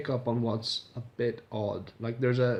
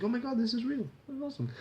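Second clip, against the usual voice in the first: much higher, gushing realization; excited; 10 semitones higher, 4 dB quieter, faster than usual. Retold or imitated. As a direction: squeal it like a thrilled fan. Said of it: "I was like".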